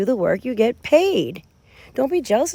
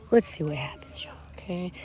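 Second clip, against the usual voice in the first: soft secretive whisper; 3 semitones lower, 9 dB quieter than usual. Real, not acted. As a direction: hush it to the viewers. Secretive aside to viewers.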